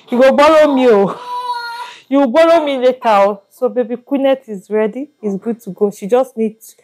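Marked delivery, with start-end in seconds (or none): 0.1-3.4 playful teasing. Real, not acted. Mother admiring baby's short skirt, playfully teasing.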